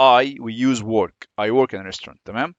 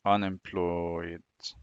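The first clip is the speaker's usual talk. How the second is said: very slow, low, soft "Unemployed"; drawn out while writing; 7 semitones lower, 10 dB quieter, much slower than usual. Shown as a demonstration. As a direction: stretch "Unemployed" out long and quietly as you write. Drawing the word out while writing.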